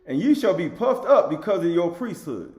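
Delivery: scripture proclamation. Acted. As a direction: proclaim the verse to the congregation. Reads scripture aloud emphatically.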